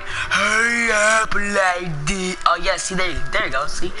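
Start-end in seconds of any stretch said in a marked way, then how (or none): 0.0-2.4 slow. Shown as a demonstration. Player making the lady understand, mockingly slow. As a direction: stretch it out slowly for someone not getting it. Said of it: "I gotta say it slow".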